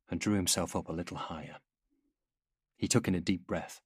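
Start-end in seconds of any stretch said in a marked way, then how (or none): none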